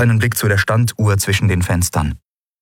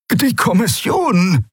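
much higher, slow frightened croak on "Die Kommission"; anxious croak; 14 semitones higher, slower than usual. A narrator's reading. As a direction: croak it out nervously. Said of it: "krächzte Rubandra ängstlich". (ängstlich gekrächzt)

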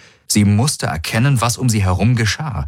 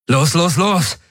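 high, rapid-fire urging; 9 semitones higher, slower than usual. A narrator's reading. Garland rushes the others into the ship, urgent. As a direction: hurry everyone aboard, pitched up.

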